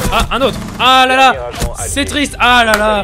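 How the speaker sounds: loud high hammed-up wail; mock lament. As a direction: wail it like a tragedy. Exaggerated lament while gaming.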